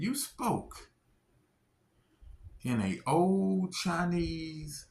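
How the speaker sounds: a hint of the surprised doctor, drawn out; gently imitating a surprised woman; quoting the doctor. Quoting the Chinese doctor's words.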